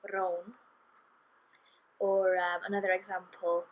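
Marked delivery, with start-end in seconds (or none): 0.0-0.6 "brown" with a rolled R and the Welsh O; "brown" said the Welsh way with a trilled R. Shown as a demonstration. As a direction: roll the R in "brown", narrow O. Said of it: "with the rolled R".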